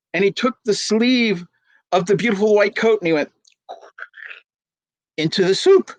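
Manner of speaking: ordinary delivery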